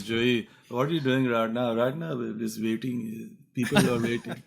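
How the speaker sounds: imitated Indian-accented uncle complaining; Indian-accented family grumbling, played for laughs; uncle's Indian accent. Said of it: "so that's how he sounds".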